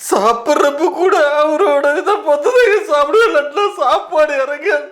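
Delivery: hammed-up weeping with a laugh breaking through; crying. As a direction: sob out the fan's heartbreak. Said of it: "இந்த அளவுக்கு அழுகுறீங்களா".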